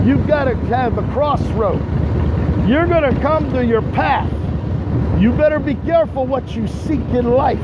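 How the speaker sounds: big declamatory trash talk; hyped promo. Acted. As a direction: work the crowd like a wrestling promo. High-energy promo addressed to Scotty.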